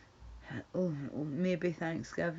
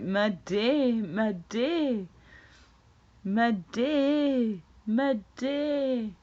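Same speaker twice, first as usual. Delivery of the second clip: mocking echo; 6 semitones higher, 7 dB louder, much slower than usual. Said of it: "I'm like".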